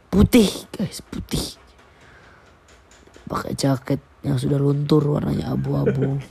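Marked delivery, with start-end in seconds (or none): 0.1-1.6 hushed whisper. Acted. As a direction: whisper it like a secret. Gossipy storytelling aside.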